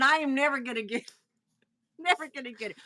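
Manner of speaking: a laugh breaking into never gonna get it; giggling at her own failure; laughing self-mockery. Real, not acted. Laughing at herself for failing the word, giggly. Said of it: "I'm a Texan".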